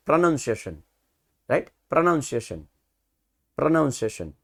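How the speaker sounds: pronunciation with a nun sound, not noun; correct pronunciation. Shown as a demonstration. Teacher drilling the correct word for students, careful and clear. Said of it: "it is actually pronunciation".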